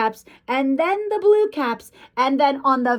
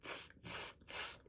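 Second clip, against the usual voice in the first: soft voiceless "tss" sounds; monkey chatter; 17 dB quieter than usual. A narrator's reading. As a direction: imitate monkeys with little tongue-and-teeth noises. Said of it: "and they said".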